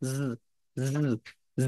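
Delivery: জ said as a buzzing z; voiced z sound. Shown as a demonstration. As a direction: buzz জ like a z. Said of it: "জ উচ্চারণ হবে".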